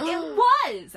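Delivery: high, loud protest; shouted. A narrator's reading. Girl defending herself to a smirking friend, shouting. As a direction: insist at a shout. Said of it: "I shouted".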